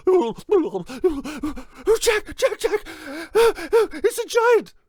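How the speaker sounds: very high, slow terrified wail; mother shrieking. Acted. Mother panicking again about the giant, wailing. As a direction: wail it out in terror.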